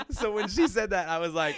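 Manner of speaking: loud and high, laughing; loud laughter. Real, not acted. Laughs hard.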